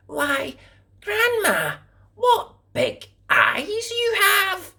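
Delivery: put-on high, slow amazed girl; little girl's wonder. A narrator's reading. Girl wondering at grandma's eyes, high and slow. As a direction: marvel wide-eyed like a child. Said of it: "she said".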